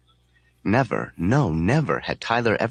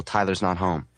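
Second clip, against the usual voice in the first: ordinary delivery, nothing marked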